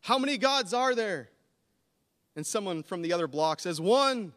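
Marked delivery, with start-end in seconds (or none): 0.0-1.3 loud, high call down the street; calling out to strangers on the street; yell out. Pretend. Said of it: "just yell out". 3.8-4.3 loud, high call back; shouted answer. Pretend. Stranger calls answer across block.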